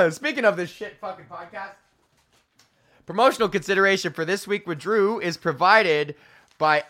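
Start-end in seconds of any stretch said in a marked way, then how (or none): 3.1-6.8 higher announcer sponsor read. Acted. Host starts the podcast ad read in announcer voice. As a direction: read it like a radio spot.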